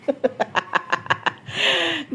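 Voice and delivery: soft, staccato laughing fit; real laugh. Real, not acted. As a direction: giggle away at the grumpy pet. Laughing at the sulking dog. (mahinang hagikgik)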